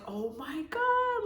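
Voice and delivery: slow, stretched-out oh my God in awe; awed oh my God. Retold or imitated. Fan seeing [name] perform, drawn-out starstruck awe. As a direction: let it melt out, starstruck. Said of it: "I was just like".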